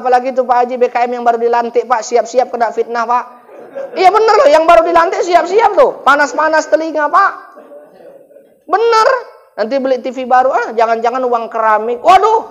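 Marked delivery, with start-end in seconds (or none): 3.9-12.4 mock gossip. Mocking gossip about BKM.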